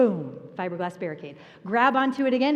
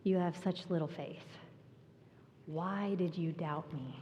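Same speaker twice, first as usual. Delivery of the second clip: soft, quieter and tender; gentle Jesus; 4 semitones lower, 10 dB quieter than usual. Acted. Jesus gently asking Peter why he doubted, tender. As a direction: say it as a loving reassurance. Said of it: "But that's not what Jesus said".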